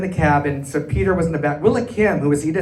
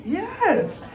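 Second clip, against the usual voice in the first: giddy yes. Retold or imitated. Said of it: "I said".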